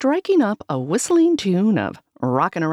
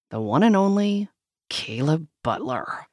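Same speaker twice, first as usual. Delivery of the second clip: usual speaking voice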